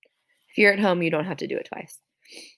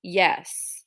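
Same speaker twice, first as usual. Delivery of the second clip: ordinary talk, nothing marked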